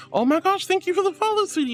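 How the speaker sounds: high, squealy thank you; excited thanks. Real, not acted. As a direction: gush over a new follower. Thanking a new follower.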